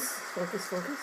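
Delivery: quiet self-reminder under her breath; muttering to self. Real, not acted. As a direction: mutter it to yourself. Muttering to herself mid-sentence.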